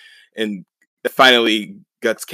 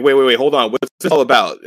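higher, louder mock protest; retold alarmed protest; 4 semitones higher, 7 dB louder than usual. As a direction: act out being caught off guard, voice raised. Acted protest at sudden attack.

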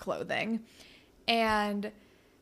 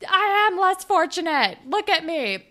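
high, loud, dramatic protest; hammed-up self-pitying outburst; mock indignation; 8 semitones higher, 5 dB louder than usual. [name] pleads her case to Claire, mock indignation.

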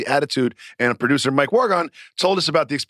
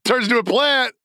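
high, hammed-up imitation of an insistent explainer; mock aggressive; 6 semitones higher than usual. Host imitating the caller on coriander, mock aggressive. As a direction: imitate an over-forceful lecture, pitched high. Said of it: "he did sound aggressive. He's like".

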